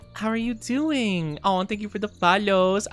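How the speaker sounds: ordinary talk, nothing marked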